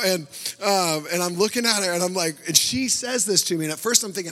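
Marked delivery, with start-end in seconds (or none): none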